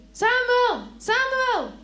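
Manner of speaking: high, loud call from afar. A narrator's reading. Unseen voice calling the boy again, loud. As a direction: call the name out across the night. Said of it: "dan hoort hij weer".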